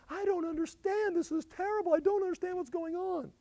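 very high mock wail; confused, distraught. People as judgment comes down, hammed-up high distraught wail. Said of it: "leave in such a confused, distraught".

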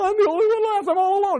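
high, mock-crying whine; whiny self-pity. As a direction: sob it like a sulking child. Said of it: "He's like".

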